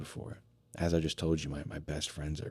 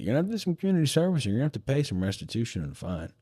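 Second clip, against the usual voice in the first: breezy lawyer; 9 semitones higher, much faster than usual. Retold or imitated. Lawyer lists his sentence.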